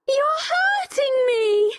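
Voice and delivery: high-pitched cry of protest; pained cry. A narrator's reading. Becky to the man gripping her, crying out high. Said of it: "cried Becky".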